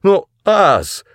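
very high, loud plea; pleading cry, voice shooting up; wailing protest. A narrator's reading. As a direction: yell out in dismayed objection. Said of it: "завопил я".

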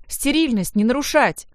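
high, loud order; nurse's shout. A narrator's reading. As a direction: call out a sharp prohibition. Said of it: "закричала медсестра Ирина".

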